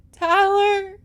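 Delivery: high, loud, drawn-out anguished cry; wailing. A narrator's reading. Girl screams after departing rider, wailing. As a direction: wail the name after him. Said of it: "she wailed".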